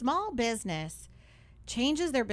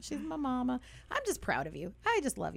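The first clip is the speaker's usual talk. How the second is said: put-on little-girl voice; 4 semitones higher than usual. Shown as a demonstration. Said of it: "Like I'm a little five-year-old".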